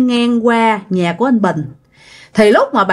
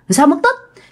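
"Sao mất tích?" again, much higher and more alarmed; repeated "Sao mất tích?", pitched up in disbelief; more startled question; 7 semitones higher than usual. Retold or imitated. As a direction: push the shock higher as it sinks in. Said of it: "bạn mới hết hồn".

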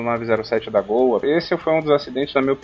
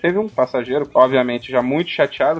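usual speaking voice

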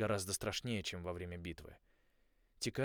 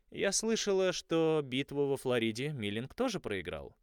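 polite; 5 semitones higher, 5 dB louder than usual. Acted. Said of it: "вежливо ответила она".

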